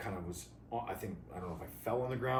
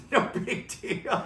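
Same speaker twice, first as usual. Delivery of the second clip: high, loud laughing echo; words breaking up with laughter; 13 semitones higher, 6 dB louder, slower than usual. Real, not acted. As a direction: repeat it through real laughter. Laughing at the understatement.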